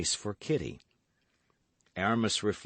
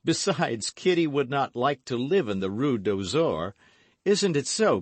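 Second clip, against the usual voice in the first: loud, high-pitched playful hero; teasing gallant young man; 7 semitones higher, 6 dB louder than usual. A narrator's reading. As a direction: tease the maid brightly, voice raised. D'Artagnan teases Kitty.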